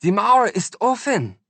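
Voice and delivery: high, loud German exclamation; jubilant German shout. A narrator's reading. Grinning woman shouting the news at the boy. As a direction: cry it out in German with joy. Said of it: "and shouted".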